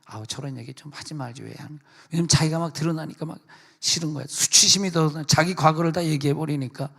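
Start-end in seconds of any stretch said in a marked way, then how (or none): 0.0-1.8 grumbling hushed complaint; annoyed mutter. Retold or imitated. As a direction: mutter it under your breath. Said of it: "막 싫어해".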